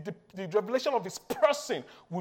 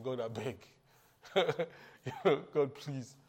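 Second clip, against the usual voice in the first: mock pleading; 8 semitones lower, 6 dB quieter than usual. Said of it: "now he's pleading".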